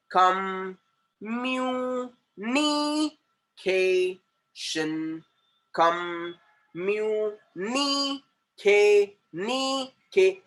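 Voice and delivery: "Communication" very slowly, each syllable held. Shown as a demonstration. Teacher showing where sound changes, "Communication" slowly split into syllables. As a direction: stretch "Communication" into separate long sounds with pauses. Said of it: "Wherever the sound is changing".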